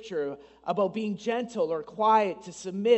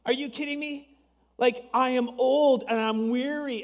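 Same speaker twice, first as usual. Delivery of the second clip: higher, half-laughing disbelief; mock incredulous retort; incredulous Sarah; 5 semitones higher, 3 dB louder than usual. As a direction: scoff at the news like an old woman who can't believe it. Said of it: "she's laughed at him and said".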